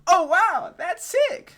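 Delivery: very high, loud mock-excited squeal; hammed-up enthusiasm. Gamer auditioning as the trailer's talker, squealing mock enthusiasm. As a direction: imitate a gushing presenter way up high, full blast. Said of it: "She sounded so enthusiastic about it".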